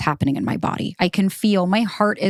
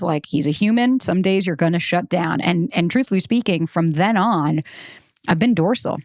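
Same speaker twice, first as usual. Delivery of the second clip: ordinary talk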